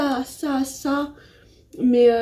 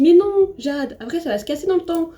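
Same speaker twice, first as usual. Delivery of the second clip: higher-pitched mock naysayer; imitated objection; 8 semitones higher, 6 dB louder than usual. People warning her off a Twingo, mock objection. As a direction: mimic someone protesting, pitched up and louder at first. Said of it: "sauf qu'on me dit".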